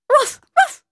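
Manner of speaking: very high, short puppy yaps; dog bark. Acted. Puppy barking on a mountain walk, yapping. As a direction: bark like an excited little dog. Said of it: "celui-ci se mit à aboyer".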